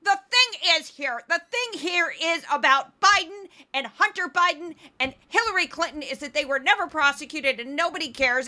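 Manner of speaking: mock outraged talking head, worked up; Tomi Lahren. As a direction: rant without stopping, hammed-up indignation. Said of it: "I'm getting worked up".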